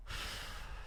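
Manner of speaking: a slow, soft breath out; soft long sigh. Shown as a demonstration. Coach modeling a calming breath for viewer, soft sigh. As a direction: model a release with one quiet sigh. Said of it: "Take a deep breath".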